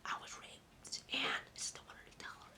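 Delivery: imitating an emotionless whisper; flat whisper. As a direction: breathe it out with no feeling. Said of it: "she didn't show any of it".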